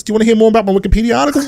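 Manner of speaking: fast, eager gabble; rushed mock plea. Mocking Armstrong's dull backstory, fast and eager. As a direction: rattle it off like an ignored sidekick. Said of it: "And Armstrong's like".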